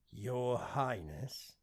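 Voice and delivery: slow, lightly robotic servant voice; drawn out like a polite machine; an avatar answering its prince, slow and formal; male AI voice. Acted.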